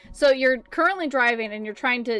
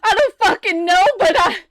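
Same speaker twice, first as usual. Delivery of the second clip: loud, higher, fast near-crying shout; panicked cry; 9 semitones higher, 7 dB louder, faster than usual. Acted. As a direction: blurt it out in terror. Panicked Nick answering.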